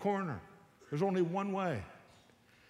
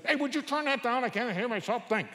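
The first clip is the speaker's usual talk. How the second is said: much higher, louder irritated yell; annoyed parent shout; 9 semitones higher, 4 dB louder than usual. Retold or imitated. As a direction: snap at the kid over loud music. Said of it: "what do most of you say".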